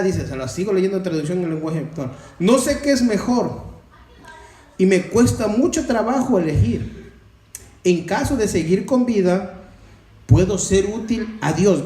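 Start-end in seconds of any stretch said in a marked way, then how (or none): 2.4-11.8 loud, raised preacher's voice; emphatic scripture reading. Acted. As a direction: read the verse out forcefully to the congregation. Reads scripture aloud to congregation.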